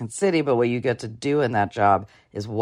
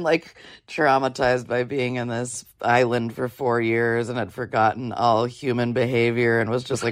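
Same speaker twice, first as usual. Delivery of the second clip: ordinary talk, nothing marked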